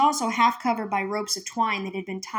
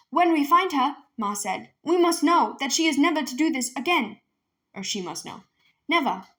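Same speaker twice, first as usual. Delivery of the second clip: stern; 6 semitones higher, 4 dB louder than usual. Acted. Ma stern about daughter.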